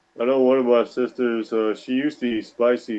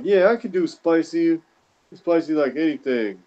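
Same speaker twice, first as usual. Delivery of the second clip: mock cocky brag; 6 semitones higher than usual. Uncle bragging he handles spice.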